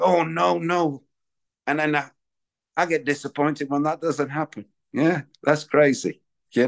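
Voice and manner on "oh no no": dizzy dismay. Retold or imitated. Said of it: "and you think".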